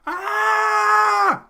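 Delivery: piercing high scream into the phone; retold scream. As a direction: let out a sudden shrill shriek. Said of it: "I hear this lady screaming".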